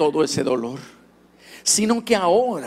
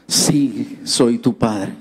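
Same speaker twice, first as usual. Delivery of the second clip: father's voice; 5 semitones lower, much slower than usual. Retold or imitated. Father speaking on phone.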